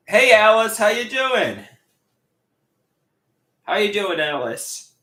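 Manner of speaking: loud, high cheerful hello; excited greeting. Real, not acted. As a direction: greet her brightly and loudly.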